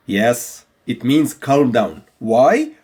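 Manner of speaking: ordinary talk, nothing marked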